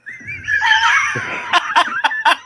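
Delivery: high shrieking laugh. Real, not acted. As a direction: crack up at your own line. Laughing at own joke.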